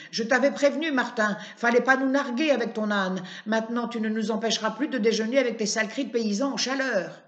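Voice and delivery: loud gloating tirade, fast at the end; old woman screaming at Martin after the shot; shouting insults. Acted. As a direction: yell it triumphantly at Martin. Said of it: "Les cris de la femme reprennent".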